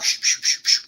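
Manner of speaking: quick repeated "shh" whooshes; imitated rushing noises. Mother running upstairs over mess, imitated whooshing.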